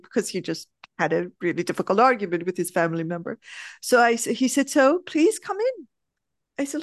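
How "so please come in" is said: high, mock-polite retold invitation; gently sarcastic. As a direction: invite them in with light, unharsh sarcasm. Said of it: "very beautifully sarcastic, but not, not harsh".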